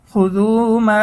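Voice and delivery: মা held for three alifs; long madd. Shown as a demonstration. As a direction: stretch the vowel of মা long. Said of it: "তিন আলিফ টেনে পড়তে হবে মধ্যে মুনফাসিল". (মা দীর্ঘ টেনে)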